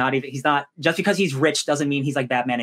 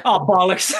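louder, higher mock exclamation through a laugh; Steven; 4 semitones higher, 6 dB louder, slower than usual. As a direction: blurt it out in imitation, laughing. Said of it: "and Steven's like".

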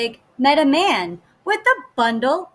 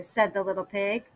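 plain reading voice

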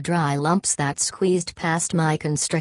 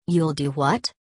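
ordinary delivery, nothing marked

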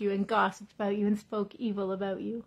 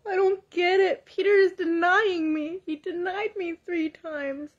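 higher, louder, slow self-pitying whine; woe-is-me Jesus; 6 semitones higher, 6 dB louder than usual. Pretend. Preacher imagining a self-pitying Jesus, whining higher and louder. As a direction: whine like a hammed-up martyr. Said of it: "imagine if Jesus was woe is me".

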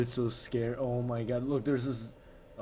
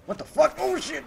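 shriek, far higher and louder; terrified scream; 9 dB louder than usual. Real, not acted. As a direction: caught off guard, scream it. Sudden zombie attack.

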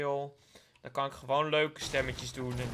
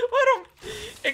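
laughing squeal; 11 dB louder than usual. Real, not acted.

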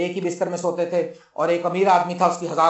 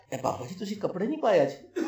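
hushed teasing; 10 dB quieter than usual. Acted. Eve teases Adam about clothes.

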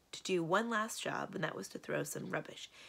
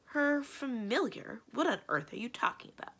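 indignant denial; 6 semitones higher than usual. A narrator's reading. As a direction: act affronted by the accusation. Enchantress feigns ignorance when accused.

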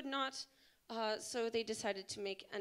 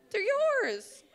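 excited insistence; 12 semitones higher, 10 dB louder than usual. Real, not acted. Pressing flowers on Jamie.

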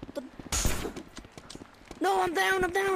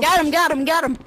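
loud excited callout; excited shout; 3 semitones higher, 11 dB louder than usual. Real, not acted. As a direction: shout it out in triumph. Shouting during a kill.

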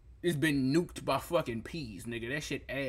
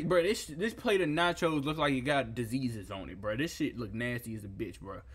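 usual speaking voice

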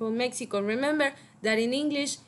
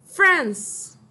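"France" said loud and high with a falling tune, for the class to repeat; teacher projecting "France" to the class; loud model word; 5 semitones higher, 7 dB louder than usual. Shown as a demonstration. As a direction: call out "France" clearly as a model. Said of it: "I want you to repeat after me".

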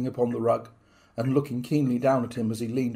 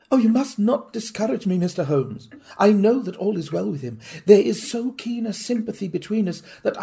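pleading wife; 8 semitones higher than usual. Acted. Wife pleading with Holmes, higher urgent voice. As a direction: plead as if her faith is everything.

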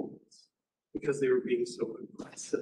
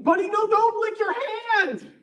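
put-on much higher, louder, slower pleading to a child; alarmed plea; 9 dB louder, slower than usual. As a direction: stop the kid licking his hand. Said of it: "I'm just like".

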